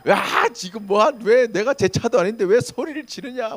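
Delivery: loud, high angry protest; 싸우듯 따지기. Pretend. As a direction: act out yelling back in a quarrel. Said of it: "막 욕을 하고 막 싸웠겠죠". (크고 높은 소리로 화내며 따지기)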